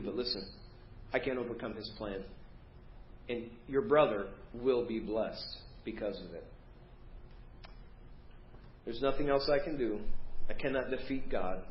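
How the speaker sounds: soft, low, subdued confession to a son; resigned father. Acted. Isaac telling Esau he cannot overcome God's plan, quietly resigned. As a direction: drop the voice, pleading gently and giving in.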